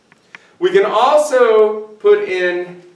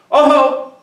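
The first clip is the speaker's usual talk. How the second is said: loud exclamation; 9 semitones higher, 6 dB louder than usual. Real, not acted. Teacher flags a warning.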